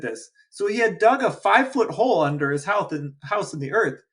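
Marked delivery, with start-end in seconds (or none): none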